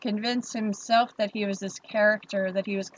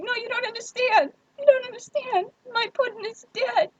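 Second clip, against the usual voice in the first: very high, hammed-up grieving voice; mock sob story; 13 semitones higher than usual. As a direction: overplay the grief to win sympathy. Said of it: "I sell him the whole sob story again".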